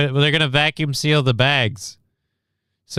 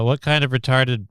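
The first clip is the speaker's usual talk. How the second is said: ordinary delivery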